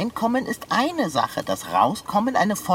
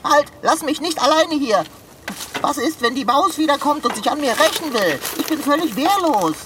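high, alarmed voice; raised pitch, growing panic; anxious pleading; 5 semitones higher, 3 dB louder than usual. Acted. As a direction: beg not to be abandoned. Trapped cat begs not to be left.

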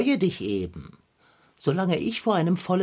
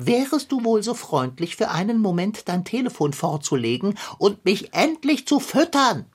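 mock-polite request turning impatient and higher; exasperated demand; 3 semitones higher than usual. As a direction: build up to an indignant, high finish. Hungry cat demands to be fed.

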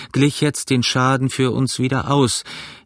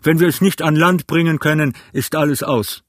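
urgent raised captain's voice; shouting; 5 semitones higher than usual. A narrator's reading. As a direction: shout it over the wind. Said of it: "schrie der Kapitän".

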